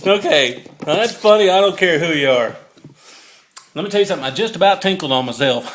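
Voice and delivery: loud, giggly, still cracking up over the near drop; fighting off the giggles; still laughing. Real, not acted. Cracking up after nearly dropping phone.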